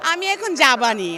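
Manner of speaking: Faridpur dialect. Shown as a demonstration. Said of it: "mixed ভাষা ফরিদপুরের".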